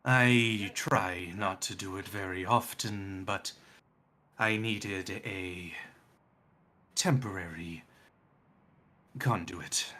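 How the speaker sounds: slow, halting draconic god; Null. Acted. Death god explains borrowing a drake's body, slow and measured. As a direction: draw it out with long, grave pauses. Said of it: "it sounds like Null".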